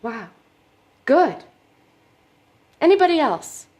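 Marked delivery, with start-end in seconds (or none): 1.0-3.7 much louder, pitch lifted; brisk loud prompt. Acted. Therapist asking the group for another volunteer, loud. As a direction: call out to the whole room.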